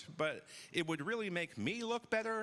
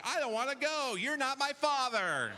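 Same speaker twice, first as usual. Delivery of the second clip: mock kids' shout; 10 semitones higher, 4 dB louder than usual. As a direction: yell it like a bratty kid refusing to go. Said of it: "shouting things like".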